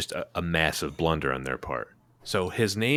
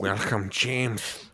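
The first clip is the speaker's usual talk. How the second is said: villain voice; 3 semitones higher, much slower than usual. Retold or imitated. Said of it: "I don't know what mine sounds like".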